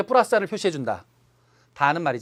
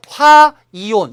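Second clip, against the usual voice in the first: 화 held long and loud, then 이온; stress on 화; 3 semitones higher, 11 dB louder, much slower than usual. Shown as a demonstration. Teacher showing how anion names are read, stress on 화. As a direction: hit 화 hard in 화이온 and stretch it. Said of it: "이렇게 읽죠".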